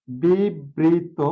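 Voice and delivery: বিবৃত drawn out very slowly, syllable by syllable; slow emphasis. Shown as a demonstration. Teacher repeating the term slowly. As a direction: stretch বিবৃত to drive it home.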